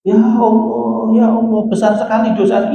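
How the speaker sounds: a retold terrorist weeping over his sins, lightly acted; tearful repentant plea. Repentant bomber crying out to God, mock weeping. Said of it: "Teroris ini nangis".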